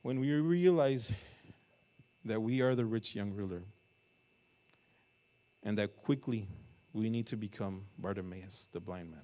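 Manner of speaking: fervent preaching. Acted. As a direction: drive the appeal home to the church. Preaching at full force.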